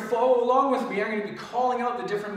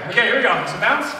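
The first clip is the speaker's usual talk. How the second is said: excited coach; 8 dB louder than usual. Real, not acted. Energetic coaching shout.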